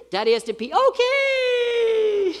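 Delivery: high, urgent plea rising to a squeal; desperate dad. Retold or imitated. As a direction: play a dad desperate to get free. Acted dad begging to be released.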